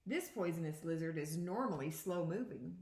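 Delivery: ordinary talk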